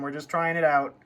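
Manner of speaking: normal talking voice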